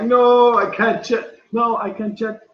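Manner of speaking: hammed-up distress over a broken keyboard; mock panic. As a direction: play up fake alarm.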